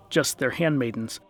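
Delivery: ordinary delivery, nothing marked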